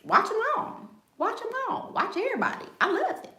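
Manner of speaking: very high sing-song calling; playful high-pitched sing-song. Real, not acted. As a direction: croon it way up high. High sing-song at live viewers.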